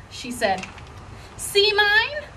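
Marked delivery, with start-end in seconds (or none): 1.4-2.2 put-on high, eager little girl; excited showing off. Grace jumping in to show Nana her pajamas, high and eager. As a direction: demand attention eagerly.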